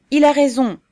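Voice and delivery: high, loud endorsement; emphatic agreement. A narrator's reading. Red-haired youth standing up to back his comrade, emphatic. Young man rises to agree.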